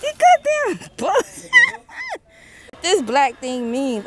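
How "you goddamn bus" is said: high, drawn-out yell; shrieked curse. Real, not acted. Yelling mid-obstacle after screaming.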